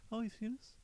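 small, high, soft polite voice; imitated polite frontman. Singer pleasantly surprised by a fan, high soft imitation. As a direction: shrink the rock star into a timid, polite guy. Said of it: "this really polite guy".

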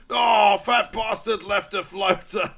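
high, loud, drawn-out hammed-up wail; mock outraged cry. Retelling the discovery in the toilet, mock outraged cry. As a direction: bellow it slowly in mock horror. Acted outcry at finding an unflushed toilet.

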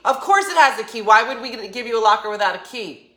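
loud, indignant retort; aggressive. Retold or imitated. Annoyed guide answering the locker question, loud indignant. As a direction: scoff at a silly question. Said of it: "getting a little bit aggressive".

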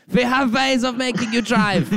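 menacing German officer voice, high; hammed-up Gestapo interrogator; cool foreign spy menace. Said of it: "like the Gestapo".

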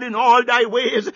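ordinary talk, nothing marked

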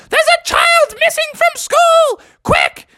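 very high-pitched frantic crowd; rally everyone in a squealing panic; urgent alarm. Acted. Dance class starts a search, urgent and shrieking.

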